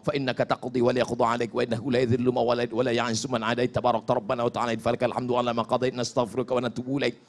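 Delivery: low, soft, fast rattle of Arabic; rapid recitation. Shown as a demonstration. Preacher skimming the rest of the prayer, rapid recitation. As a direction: rattle it off from memory, quietly.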